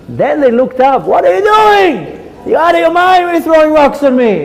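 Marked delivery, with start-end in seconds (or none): 1.1-4.4 high, outraged mock shouting; indignant protest. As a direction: scold the rock-thrower upwards, hammed-up. Struck man yells up at thrower.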